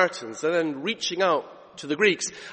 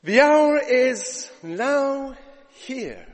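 slow emphatic proclamation; 6 semitones higher, 4 dB louder, much slower than usual. Acted. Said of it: "climax line".

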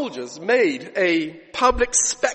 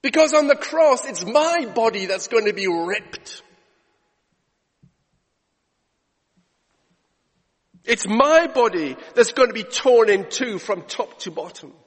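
louder, higher preaching voice; preaching at full force; 4 semitones higher, 6 dB louder than usual. Acted. Preacher to congregation about the cross, fervent. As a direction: preach it out with conviction.

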